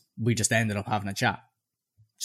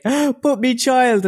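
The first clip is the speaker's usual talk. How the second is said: very high, drawn-out mock wail; tearful begging woman; 12 semitones higher, 5 dB louder, much slower than usual. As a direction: wail it slowly like a pleading mother. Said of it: "kept crying about her child".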